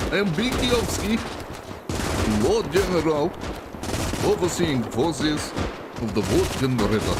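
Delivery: lightly put-on Russian general; pompous Russian lord general; Russian accent. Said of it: "Pinky, Pinkyovsky".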